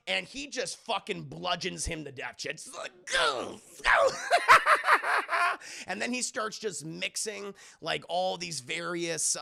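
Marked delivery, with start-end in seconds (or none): 3.1-4.1 high, loud grunted hits; imitated blows. Imitates the bludgeoning. 4.1-5.8 high, loud villain laugh; hammed-up evil clown laughter; maniacal cackle. Joker cackles while bludgeoning.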